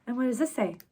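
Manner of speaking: ordinary delivery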